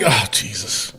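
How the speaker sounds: a low, soft disappointed groan of Jesus; letdown groan. Retold or imitated. Host recalling a letdown show, low and soft groan. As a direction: sink into disappointment on it. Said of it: "I was like".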